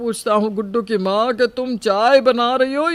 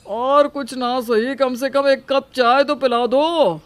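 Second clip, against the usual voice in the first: irritated calling out; 4 semitones higher, 7 dB louder than usual. Acted. Husband calling sleeping wife to make tea, irritated and loud. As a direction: call loudly through the house, annoyed.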